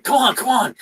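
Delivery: high, sing-song coaxing of pets; coaxing pet owner. Acted. Game master plays someone urging dog and ferret together, coaxing. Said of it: "you kinda do the thing like".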